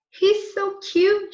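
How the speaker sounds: high, slow cooing; girl cooing over a cute puppy. Acted. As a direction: coo at the little puppy. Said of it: "she said".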